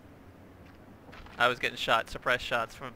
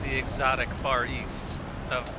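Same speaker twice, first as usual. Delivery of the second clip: mock tour guide. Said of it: "announcer parody of drop spot".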